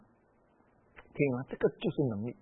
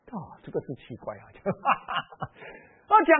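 normal talking voice

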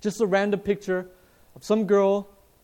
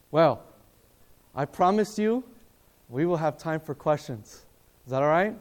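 normal talking voice

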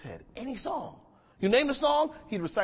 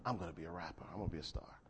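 low, lightly voiced sulky kid; a hint of a mumbling teen; 12 semitones lower, 13 dB quieter than usual. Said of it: "He was like".

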